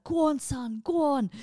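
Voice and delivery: high, loud crowd cheer; mock cheer. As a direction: egg him on like a rowdy spectator. Village cheering the couple on.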